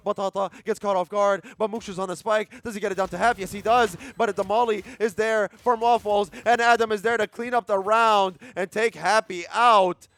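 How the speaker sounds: loud, high hype call; esports caster. Real, not acted. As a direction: roar the round to its finish. Caster calls round finish.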